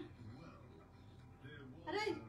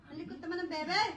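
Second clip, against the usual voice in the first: excited exclaim; 6 semitones higher, 8 dB louder, much faster than usual. Real, not acted. To the wriggly baby, excitedly louder. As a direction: cry it out, thrilled.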